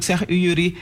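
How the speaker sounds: ordinary delivery, nothing marked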